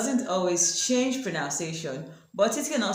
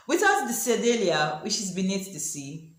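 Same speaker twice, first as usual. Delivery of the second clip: usual speaking voice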